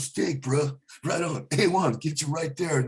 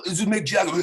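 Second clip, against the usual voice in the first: [name] impression; 6 semitones higher than usual. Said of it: "a radio intro".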